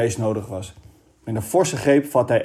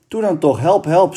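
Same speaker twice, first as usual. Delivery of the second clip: higher, louder cry for help; shouting; 6 semitones higher, 5 dB louder than usual. A narrator's reading. Kees shouts to the two farmers for help, loud. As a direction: yell to the men still far off. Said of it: "schreeuwde Kees".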